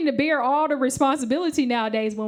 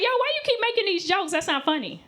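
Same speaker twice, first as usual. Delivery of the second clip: imitated annoyed complaint; 7 semitones higher than usual. Friend telling off the comic for the jokes, mock annoyed. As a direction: voice the fed-up friend, starting high.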